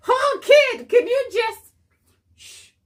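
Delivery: put-on high, loud, fed-up plea to a kid; exasperated mom. A tired mom pleading with her chatty kid, high and loud. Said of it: "when you feel like".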